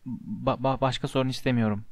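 low, soft, hesitant stutter; stammering mumble. A narrator's reading. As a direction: mumble it, catching on the first syllable. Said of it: "diye mırıldandı".

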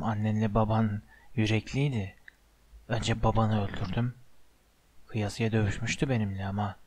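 low, hushed, menacing voice; sinister whispering villain. A narrator's reading. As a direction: murmur it darkly, barely above a whisper. (alçak, fısıltılı, tehditkâr ses)

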